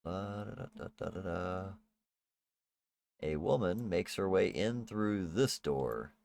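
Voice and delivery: usual speaking voice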